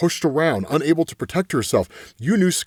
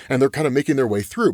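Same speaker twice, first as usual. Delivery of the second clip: ordinary talk, nothing marked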